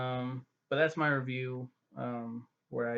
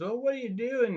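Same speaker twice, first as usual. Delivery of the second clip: high-pitched, louder heckle; mock yelling; 11 semitones higher, 5 dB louder than usual. Scotsman shouting at the screen, mock yelling. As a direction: imitate someone yelling at a movie screen. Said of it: "he's just screaming at the".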